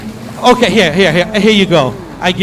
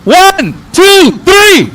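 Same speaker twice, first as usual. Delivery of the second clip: shouted countdown; 8 semitones higher, much slower than usual. Real, not acted. Host shouting the countdown at the crowd.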